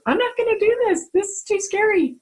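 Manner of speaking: high-pitched mock panic; scared refusal, retold. Sister telling of needing her husband's help, retold scared refusal. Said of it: "it's so scary to me".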